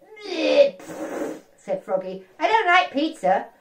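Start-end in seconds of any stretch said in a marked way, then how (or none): 0.0-1.4 loud high bleh then a spitting pfft; disgusted raspberry. Acted. Froggy refusing chicken nuggets, disgusted raspberry. Frog rejects pizza. 2.4-3.5 fussy refusal. Acted. Frog refusing food.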